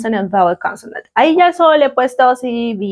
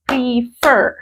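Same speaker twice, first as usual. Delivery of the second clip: PREFER split slowly into PRE and FER; syllable by syllable; 3 semitones higher than usual. Shown as a demonstration. Teacher showing students the syllables in a verb, Prefer split in two. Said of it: "¿Tiene más de una sílaba?".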